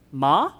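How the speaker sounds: "ma" with a rising tone; "ma" sliding upward like a question; Mandarin tone demo. Shown as a demonstration. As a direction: lift the pitch through "ma". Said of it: "differentiate them is by tones".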